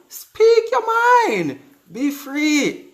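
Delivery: high, loud rallying call on "speak your", jumping up; excited cheer. Real, not acted. Urging women to speak up.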